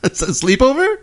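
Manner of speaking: high, puzzled question; surprised. Real, not acted. Surprised guess.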